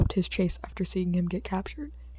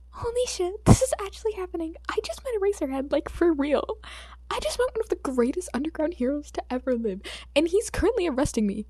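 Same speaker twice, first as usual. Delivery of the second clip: higher, fast excited babble; too excited; 9 semitones higher than usual. A narrator's reading. Starstruck boy gushes about meeting his hero, high and fast. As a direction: gush fast like a starstruck fan. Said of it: "He's too excited".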